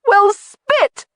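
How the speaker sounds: high, loud exasperated cry; cried out. Acted. Jamie crying out in dismay at the news, high and loud. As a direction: blurt it out in frustration. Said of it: "Jamie cried out".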